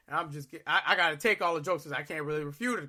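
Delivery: usual speaking voice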